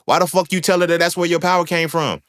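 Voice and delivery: a mock scolding of Samson; mocking disbelief. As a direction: scoff at him like a fool. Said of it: "we be looking at Samson like".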